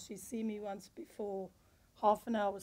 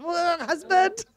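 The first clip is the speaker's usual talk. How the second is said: loud, drawn-out shocked cry; shocked exclamation; 9 semitones higher, 18 dB louder, slower than usual. Retold or imitated. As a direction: recoil from a bad smell. Retelling her reaction to the toilet smell.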